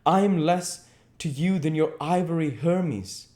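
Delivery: hurt and jealous, voice rising; a hint of a flushed angry youth; almost angry. A narrator's reading. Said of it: "He seemed almost angry".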